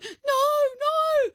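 put-on high whining child refusal; high squealed no; whiny kid. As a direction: whine no like a toddler. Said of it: "he's going".

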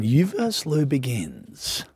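very low, slow, hushed announcer; dramatic countdown. Acted. Host counting down to the quiz start, hushed and portentous. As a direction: drop deep and soft, building suspense.

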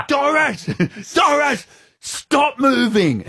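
high, comic retold shout; urgent calling out. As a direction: yell high to halt her, laughing between cries. Calling urgently to deaf old lady.

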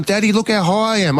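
put-on high-pitched kid voice, boasting; proud little girl. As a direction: imitate a child calling dad to watch. Daughter shows off to dad.

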